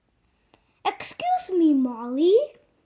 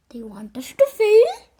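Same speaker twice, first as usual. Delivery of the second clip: whispered in disbelief, rising at the end; stunned whisper. Acted. A kid hears the assignment is to fail, whispering in disbelief. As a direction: breathe it out, shocked.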